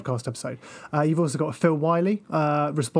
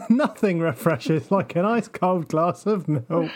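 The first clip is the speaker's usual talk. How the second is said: chuckling through the line, a bit slow; with a chuckle; slower than usual. Shown as a demonstration. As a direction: laugh your way through it. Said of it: "do it with a chuckle".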